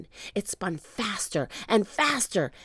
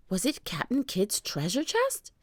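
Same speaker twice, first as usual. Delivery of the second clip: ordinary delivery, nothing marked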